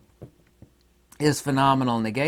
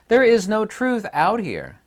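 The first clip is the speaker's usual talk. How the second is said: much higher, louder and slow, every word driven home; emphatic declaration; 11 semitones higher, 8 dB louder than usual. Real, not acted. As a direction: proclaim it as the core truth. Emphatic restatement of the key point.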